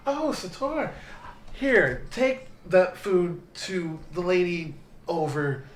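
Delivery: flustered tavern server. Acted. Server hurrying food onto Sator, lightly flustered. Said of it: "comes kinda twirling out of the back and goes".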